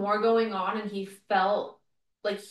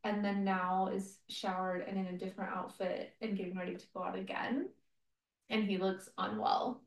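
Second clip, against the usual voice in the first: soft, hushed gossip; quiet confiding undertone; whispering; 7 dB quieter than usual. Real, not acted. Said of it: "sorry I'm whispering".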